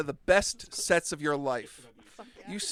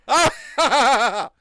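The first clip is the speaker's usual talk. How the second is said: mad scientist cackle; 6 semitones higher, 10 dB louder than usual. Acted. Therapist's villain laugh.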